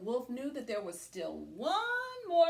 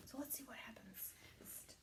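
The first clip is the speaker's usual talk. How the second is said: hushed whisper to the class; 20 dB quieter, faster than usual. Real, not acted. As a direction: lean in and whisper. Teacher whispers to kids.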